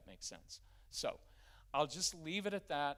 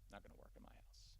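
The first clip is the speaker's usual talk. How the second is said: 1 no-drama whisper; 16 dB quieter than usual. Shown as a demonstration. Calm discipline, no drama.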